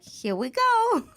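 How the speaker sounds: high, slow, eager here we go; morning enthusiasm. Retold or imitated. Waking up for her teaching job, high and eager. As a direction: greet the day with glee. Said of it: "I wake up like".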